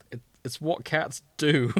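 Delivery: ordinary talk, nothing marked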